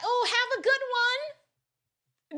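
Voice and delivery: high, sing-song have a good one; cheery farewell. Retold or imitated. Southern folks wishing strangers well, high and cheerful. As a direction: toss it off high and friendly. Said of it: "you just be like".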